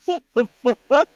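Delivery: high loud disbelieving yelp; mock outrage. Comic reacting to the singer, high loud disbelief. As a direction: blurt it in shock. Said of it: "And I was like".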